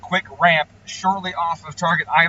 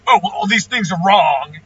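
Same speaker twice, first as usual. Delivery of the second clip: louder mock fault-finding; mocking the critic; 3 semitones higher, 7 dB louder than usual. Tuner retells another guy's complaints, mocking. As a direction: imitate a know-it-all complaint.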